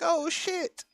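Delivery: sudden high-pitched yelp of surprise; surprised exclamation. Retold or imitated. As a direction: blurt it out, startled. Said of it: "I was like".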